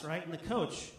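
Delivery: ordinary talk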